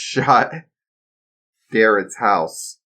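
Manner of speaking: ordinary delivery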